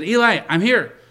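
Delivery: high, loud eager reply; eager boy. Acted. Boy Samuel running to Eli at night, eager and loud. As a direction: burst in eager to answer.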